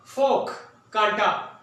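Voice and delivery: ordinary talk, nothing marked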